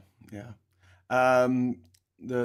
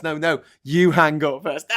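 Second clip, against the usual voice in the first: loud, slow lovey-dovey insisting; giggly late night call; 7 dB louder than usual. Retold or imitated. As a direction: playfully insist they hang up first. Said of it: "Is how I imagine it went".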